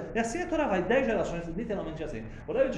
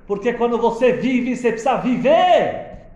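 full of life; 6 semitones higher, 11 dB louder than usual. Shown as a demonstration. Teacher contrasting life and death, ringing loud. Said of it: "lendo a vida e a morte igual".

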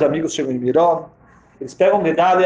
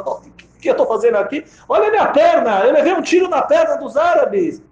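indignant protest; 9 semitones higher than usual. Acted. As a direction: shout back, pointing at the leg. Gedalia protests his wound.

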